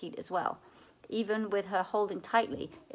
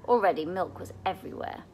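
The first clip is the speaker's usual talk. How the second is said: ordinary delivery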